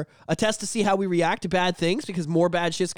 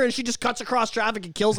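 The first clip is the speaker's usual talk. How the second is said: ordinary talk, nothing marked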